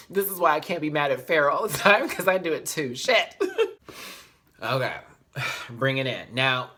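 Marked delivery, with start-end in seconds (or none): 3.4-4.1 high teary laugh-cry. Real, not acted. Vlogger overcome about her friend, high laughing sob.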